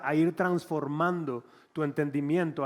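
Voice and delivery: normal talking voice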